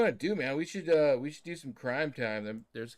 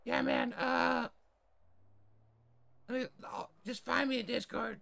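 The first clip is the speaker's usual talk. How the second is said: high-pitched, strained tough guy; kicked in the nuts voice; 13 semitones higher than usual. Acted. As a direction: sound like you just got kicked in the nuts. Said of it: "kicked in the nuts voice".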